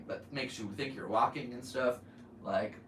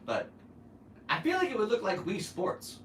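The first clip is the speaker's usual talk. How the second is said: normal talking voice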